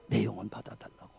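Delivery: very soft, low prayer; subdued dying plea. Retold or imitated. Retelling a dying martyr's prayer, hushed low plea. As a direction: let the plea drop low and quiet.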